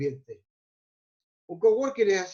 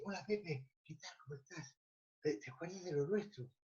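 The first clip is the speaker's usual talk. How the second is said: hushed, timid whisper, much softer; fearful whisper; 2 semitones lower, 17 dB quieter than usual. Shown as a demonstration. Salesman greeting a client inside a cathedral, scared whisper. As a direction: whisper as if afraid to be overheard. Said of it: "Así, susurrando, susurrando, con miedo".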